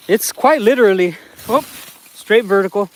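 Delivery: ordinary talk, nothing marked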